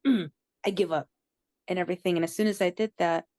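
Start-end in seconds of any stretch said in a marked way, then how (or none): 0.1-1.1 pouting. Retold or imitated. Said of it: "I pouted and I went".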